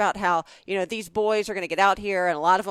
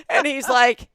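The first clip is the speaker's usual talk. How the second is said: usual speaking voice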